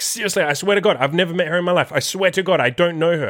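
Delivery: high-pitched imitation of her protesting; insistent swearing. Retells date swearing she's honest.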